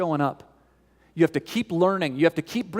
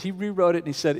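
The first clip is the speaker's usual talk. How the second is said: ordinary talk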